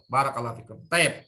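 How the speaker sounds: ordinary talk, nothing marked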